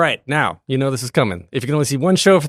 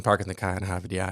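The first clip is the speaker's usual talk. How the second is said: put-on low, soft Boston accent with dropped R's; mock Boston accent; 7 semitones lower, 10 dB quieter than usual. Host teasing guest about hometown, mock Boston accent.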